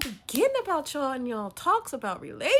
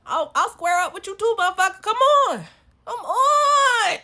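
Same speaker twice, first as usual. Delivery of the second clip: high, loud yell squaring up for a fight; rising fighting shout, last words stretched; shouted challenge; 10 semitones higher, 13 dB louder than usual. Acted. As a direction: holler it like you are daring them to swing.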